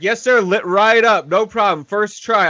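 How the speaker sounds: high, loud snide imitation of the repairman; mock his smug politeness; exaggerated sarcastic yes sir; sarcastic worker. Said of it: "He's like".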